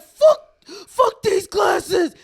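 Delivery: loud slow furious yell; angry man tossing his glasses; mock angry shout. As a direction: bark it out in disgust.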